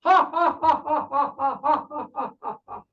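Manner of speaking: long run of hearty "ha-ha" bursts; loud mock laugh. Happy stranger at the bus stop, imitated loud laughing. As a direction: imitate a man laughing hard, burst after burst. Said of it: "जोर-जोर से हंस रहा है".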